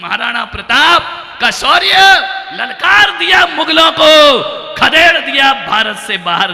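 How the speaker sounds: heroic shout. Real, not acted. Monk hails Maharana Pratap's valour with a fiery shout. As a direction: thunder like a battle call.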